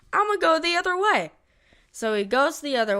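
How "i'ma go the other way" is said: mock hero thought. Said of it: "and he's like".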